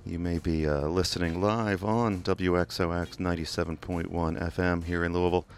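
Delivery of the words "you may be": ordinary delivery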